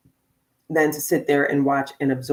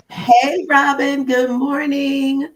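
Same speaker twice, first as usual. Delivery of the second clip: much higher, louder welcome, drawn out; excited greeting; 9 semitones higher, 5 dB louder, much slower than usual. Real, not acted. Host welcoming guest Robin on air, excited greeting. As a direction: greet her like an arriving friend.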